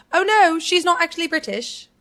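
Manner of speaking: high, loud would-be British giveaway. Shown as a demonstration. Actor demonstrating how her British fails, high and loud. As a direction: raise pitch and volume so the accent slips. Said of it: "the second that I go higher".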